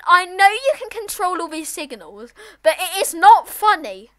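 loud, high-pitched telling-off; exasperated scolding. Real, not acted. Scolding someone controlling the signals.

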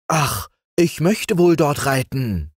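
put-on high, eager boyish wish; lightly acted eager longing. A narrator's reading. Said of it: "sagte dann Franz eifrig".

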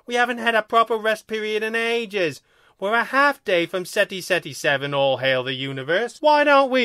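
high, indignant alien complaint; protesting second alien. A narrator's reading. Second alien arguing for a rest period, protesting.

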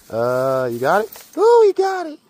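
high, loud delighted ooh; excited cheer. Real, not acted. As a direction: cheer the dog on as he makes it. Dog makes the climb.

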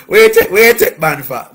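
loud, high Jamaican patois; big, comic Jamaican outburst; Jamaican accent. Acted. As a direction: repeat the joke in exaggerated Jamaican. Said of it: "in his Jamaican accent would say".

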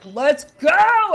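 excited. Retold or imitated. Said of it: "I was like".

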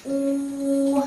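"U" held long, high and level; level vowel demonstration. Shown as a demonstration. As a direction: sustain "U" on one high pitch. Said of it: "this one is".

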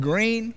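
green lifted high as a question; stress on green. Shown as a demonstration. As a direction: hit green up high to point it out. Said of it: "do you see that word".

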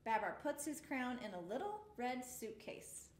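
ordinary delivery

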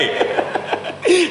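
very high burst of real laughter; helpless, breathy laugh. Real, not acted. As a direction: crack up at a funny line. Amused by the curt line.

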